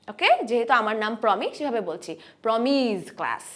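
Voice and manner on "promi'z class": প্রমিস drawn out, ending in Z; final Z sound. Shown as a demonstration. Teacher uses own name, প্রমিস ending in Z. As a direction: stretch "প্রমিস" into a voiced Z. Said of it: "স না বলে জ বলবো".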